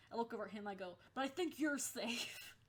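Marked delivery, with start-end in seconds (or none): 1.1-2.6 slow, pointed put-down; snide burn. Retold or imitated. Teasing the boy in a skit, slow and smug. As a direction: deliver the insult slow and sly. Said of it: "I was such an asshole".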